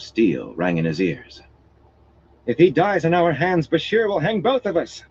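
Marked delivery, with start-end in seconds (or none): 2.5-5.0 high anxious woman's voice; frightened noblewoman. Acted. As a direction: voice a panicked lady.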